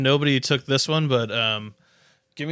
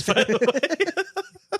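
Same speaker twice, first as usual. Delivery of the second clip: drawn out slowly through a laugh, much higher; laughing sarcasm; 13 semitones higher, much slower than usual. Real, not acted. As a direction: let the laugh carry the dry joke. Joking about podcast listeners.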